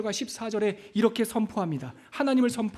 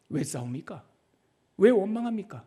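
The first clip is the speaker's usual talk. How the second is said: usual speaking voice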